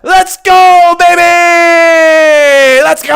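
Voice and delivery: long, high, loud victory scream; triumphant celebration. Real, not acted. Streamer hitting the subscriber milestone, triumphant scream. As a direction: scream it out, drawing out the vowels.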